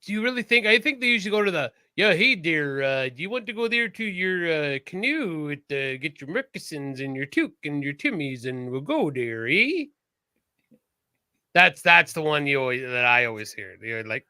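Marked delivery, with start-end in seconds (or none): 1.9-9.8 hammed-up Newfoundland Canadian lilt, rising on "eh"; broad mock Eastern Canadian accent. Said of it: "stereotypical Newfoundland Eastern Canadian accent".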